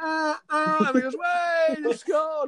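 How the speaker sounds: high, excited fan cheer well above his voice; a scout imitating passengers cheering a goal from the train; mock goal celebration. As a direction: yell it like fans as the train passes.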